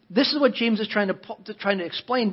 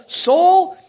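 "Soul" called out high and loud; grand self-address; 10 semitones higher, 6 dB louder than usual. Retold or imitated. The rich fool proudly addressing his own soul, loud call. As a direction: puff up like a smug rich man.

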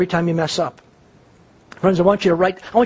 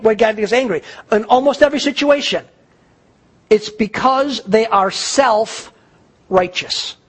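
usual speaking voice

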